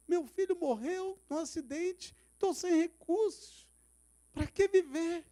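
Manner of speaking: high, slowed lament of a broken man; despairing cry. Retold or imitated. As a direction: wail out hopelessness. Suicidal man on rooftop.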